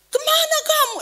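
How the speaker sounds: high, demanding father's question; acted-out parental interrogation; imitated angry questioning. Acts out father interrogating crying sister.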